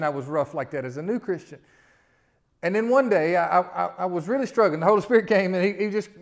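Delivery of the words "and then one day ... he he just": loud, high testimony build; preaching at full force. Acted. Preacher telling his church how the Spirit came, loudly. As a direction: lift the story like a sermon climax.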